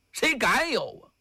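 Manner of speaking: ordinary delivery, nothing marked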